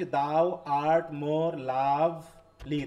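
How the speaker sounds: very slow, each syllable set apart. Shown as a demonstration. Teacher showing students the line's syllables, slow and separated. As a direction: count out the syllables with your voice. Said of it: "এখানে দশটা syllable কিভাবে করছি".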